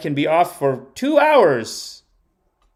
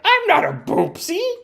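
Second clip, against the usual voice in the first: put-on very high indignant child voice; mock-stern small-child protest; stern little girl. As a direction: squeak it like a serious, offended kid. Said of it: "very stern".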